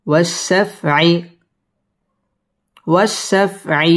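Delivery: ওয়াশফআই drawn out slowly, syllable by syllable; slow reading. Shown as a demonstration. Teacher slowly models the word.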